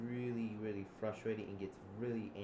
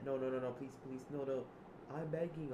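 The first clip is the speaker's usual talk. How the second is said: pleading; 4 semitones higher than usual. Acted. Acted begging in the story.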